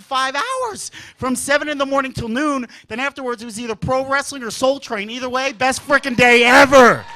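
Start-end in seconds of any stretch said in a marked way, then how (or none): 5.5-7.0 loud, emphatic closing punchline; rousing, gleeful declaration; exuberant finish. Real, not acted. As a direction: belt it out as the closer. Big finish of the routine.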